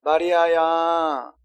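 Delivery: drawn-out tender call. Shown as a demonstration. Preacher acting Jesus calling Mary, slow and tender. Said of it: "이 말씀 잘 보세요".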